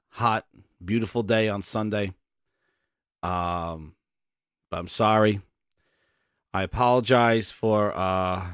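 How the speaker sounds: grovel sheepishly to the nation; Phil as weatherman. Pretend. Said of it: "He'd be like".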